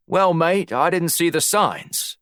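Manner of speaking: put-on high-pitched, matey Australian accent. A narrator's reading. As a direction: play it as an Aussie talking his way out. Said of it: "summoned his best Australian accent".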